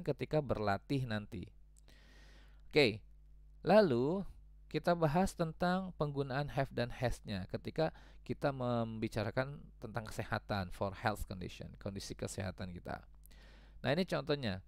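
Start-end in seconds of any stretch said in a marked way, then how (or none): none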